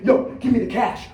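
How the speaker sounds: quick, loud street robber's demand; urgent, loud mugger; rapid-fire demand. Acted. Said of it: "real fast like that".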